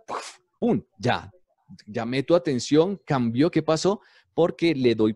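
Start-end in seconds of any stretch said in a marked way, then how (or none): 0.1-0.8 a short burst imitating a thunderclap; teacher mouths a thunder effect for radio students; thunder sound effect. Shown as a demonstration. Voicing thunder like a radio sound effect. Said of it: "por ejemplo, efecto de un trueno".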